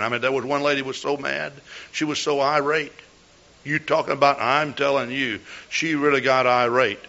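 ordinary talk

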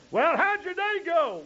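bright, high, chirpy asking; mock-cheerful family greeting; cheery question. Acted question from family member.

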